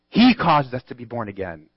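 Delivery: "He" hit first, the rest falling away; stress on HE. Real, not acted. Preacher repeating the verse to drive it home, stress on "He". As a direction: punch "He" and let the line drop.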